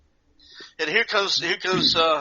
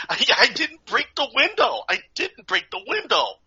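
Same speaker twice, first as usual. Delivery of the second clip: put-on protesting kid; 10 semitones higher than usual.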